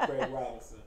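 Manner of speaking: deep, low, lazy imitation; Craig Robinson. Hearing it in the texter's voice, deep imitation. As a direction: sink way down for the name. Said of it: "I can hear it in his voice".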